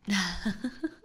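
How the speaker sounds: breathy teasing chuckle. Acted. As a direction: chuckle at the prisoner's plight. Vampire laughs at frightened captive.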